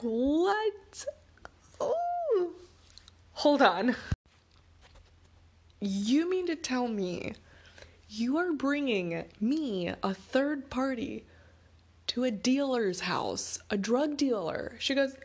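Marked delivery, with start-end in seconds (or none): 0.0-4.0 high-pitched stunned outburst, ending loud; re-enacted shock, squeaky "oh" then firm stop; shocked disbelief. Acted shock at dealer's house news. 5.8-14.7 slow, drawn-out disbelief, pitched up; re-enacted incredulous questioning, word by word. Acted incredulous questioning about dealer's house.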